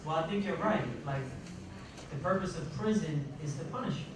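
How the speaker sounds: ordinary delivery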